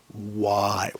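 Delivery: low, soft, wailing why; mock anguished cry. Ranting vlogger fakes despair with a soft, low wail. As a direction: moan it like a comic lament.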